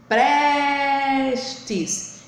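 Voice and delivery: "prestes" with a long held PRES; calling the word. Shown as a demonstration. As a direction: call out PREEEStes, short last syllable. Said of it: "chame a palavra".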